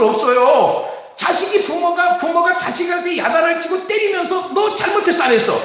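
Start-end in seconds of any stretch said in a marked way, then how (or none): 4.5-5.5 raised, pressing parent demanding an answer; pitched-up mock interrogation of a child; browbeating parent. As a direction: corner the child with questions. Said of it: "윽박지르는 이유가 뭐예요".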